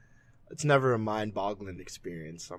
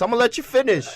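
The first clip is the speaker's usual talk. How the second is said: loud, high Kanye impression; [name] impression; 13 semitones higher, 8 dB louder, faster than usual. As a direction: shout it out like Kanye cutting in. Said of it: "just pull the [name]".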